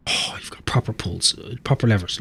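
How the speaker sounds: hushed, admiring whisper. Real, not acted. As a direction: breathe it out in awe. Admiring tavern taps.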